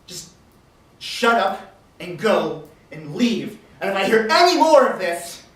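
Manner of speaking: stern dressing-down. Acted. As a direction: lay down the law, pausing between threats. Chief scolds and threatens them.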